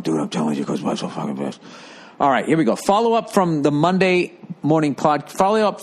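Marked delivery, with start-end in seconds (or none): 0.0-1.6 low, hushed buddy-to-buddy voice; other dads confiding. Retold or imitated. Dads your age advising the old dad, low confiding imitation. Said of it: "all the dads your age going".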